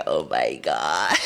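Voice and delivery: low drawn-out mock exasperation; deep slow oh my God. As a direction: drop the voice way down and drag it. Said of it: "I was like".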